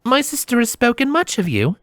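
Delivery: high, gracious princess voice; Princess Luna. Acted. Narrator voices smiling princess.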